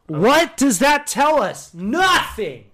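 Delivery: high, slow, exasperated cry; exasperated outburst. Real, not acted. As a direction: draw out each word in mock outrage. Mock outrage at the actor's quote.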